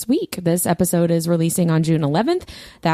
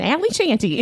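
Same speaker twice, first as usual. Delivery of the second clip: put-on Kentucky accent; 5 semitones higher than usual. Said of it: "that's my Kentucky accent".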